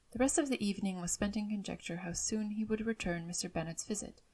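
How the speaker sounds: plain reading voice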